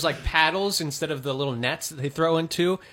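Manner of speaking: ordinary delivery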